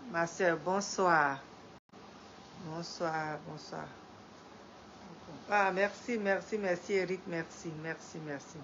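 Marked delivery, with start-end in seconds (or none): none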